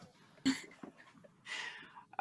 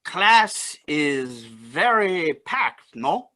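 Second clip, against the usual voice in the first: put-on slow, drawn-out Cajun accent; much slower than usual. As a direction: play Cajun, stretching each word. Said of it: "you still have to use your Cajun accent".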